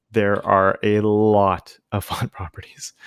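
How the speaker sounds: slow, whispered with a chuckle; laughing whisper. Real, not acted. Teacher whispering bad news to students, chuckling. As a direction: break the bad news through a hushed laugh.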